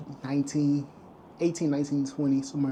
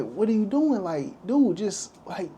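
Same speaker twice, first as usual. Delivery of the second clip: higher-pitched exasperated imitation; a little irritated; 6 semitones higher, slower than usual. Cops to a young man walking away, imitated mild irritation. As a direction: imitate a cop losing patience, pitch raised. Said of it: "the cops started to get a little irritated".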